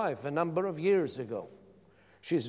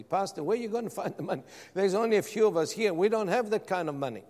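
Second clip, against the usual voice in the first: fast, higher mimicked protest; imitated doubting congregation; 4 semitones higher, faster than usual. Small congregation questioning their pastor's plan, imitated hurried protest. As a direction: rattle off their objections in mimicry.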